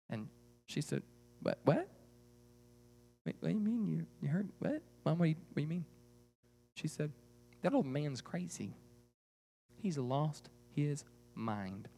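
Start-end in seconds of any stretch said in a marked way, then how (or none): 1.4-5.9 small, quiet, halting questions; bewildered girl. Acted. Girl stunned by mother's refusal. 7.6-11.9 hushed and deliberate, slowing down; grave dismissal. Acted. Mother gravely dismisses the old man.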